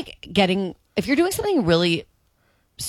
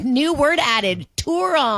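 slow, raised announcer-like proclamation; drawn-out, higher-pitched mock news of a new word; mock announcement; 6 semitones higher, much slower than usual. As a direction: proclaim it slowly, lifting high on the last word. Imagined dictionary announcement.

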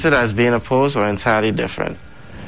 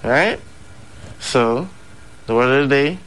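ordinary talk

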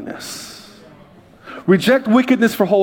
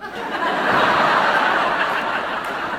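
an airy, unvoiced burst of laughter; breathy laugh; 5 dB quieter than usual. Real, not acted. Audience-facing laugh at story.

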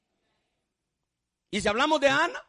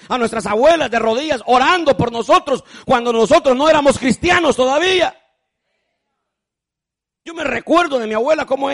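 loud, surging preacher's call; preaching at full force; 3 semitones higher, 9 dB louder than usual. Acted. Preacher urges the congregation to honor their mothers, loud fervent preaching. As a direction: drive each phrase home to the pews.

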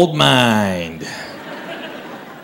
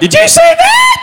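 very high, loud mock shout; can't whisper; 5 dB louder than usual. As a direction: blurt it shrieking, imitating a wife who can't whisper. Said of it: "my wife can't whisper".

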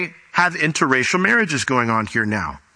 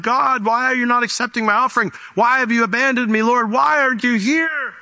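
pleading, pitched much higher, a mock wail; imitating crying worshippers; 9 semitones higher than usual. Worshippers pleading with God over rejected offerings, mock high wail. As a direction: play the weeping worshipper begging God, voice climbing high. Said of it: "and they're crying, like".

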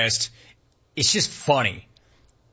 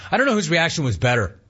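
normal talking voice

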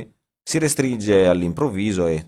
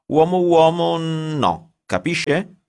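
pitched high, slow, in a put-on foreign voice; a stiff Russian-accented editor laying down the rules; 10 semitones higher, 4 dB louder, much slower than usual. A narrator's reading. Editor dictating rules to the writer, high and slow.

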